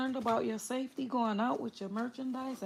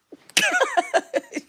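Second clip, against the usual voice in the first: shrill burst of laughter, much higher; high squealing laugh; 8 dB louder than usual. Real, not acted. Cracking up at her own threat to viewers, high squealing laugh.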